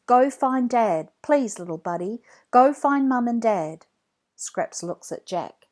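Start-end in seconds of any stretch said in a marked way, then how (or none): 0.1-3.9 louder, pleading command; urging the dog. A narrator's reading. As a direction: beg the dog to go.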